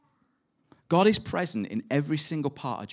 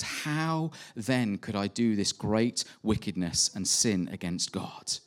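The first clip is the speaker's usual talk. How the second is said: slowed right down, each word weighed; slow emphatic quote; 3 semitones lower, 5 dB quieter, slower than usual. Acted. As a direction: let Joseph's refusal land slowly and firmly. Joseph's refusal quoted slowly.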